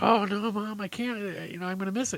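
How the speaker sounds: put-on high, whiny kid plea; protesting little boy. Boy protests mom's homework order, high and pleading. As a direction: plead like a child about to miss out.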